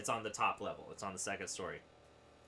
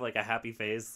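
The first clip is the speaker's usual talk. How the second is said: usual speaking voice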